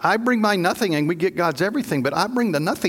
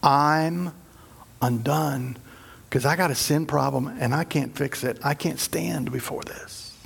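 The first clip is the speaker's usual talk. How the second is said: broken confession; 4 semitones lower, 4 dB quieter than usual. Retold or imitated. Isaiah before God's holiness, softly broken. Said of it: "He went".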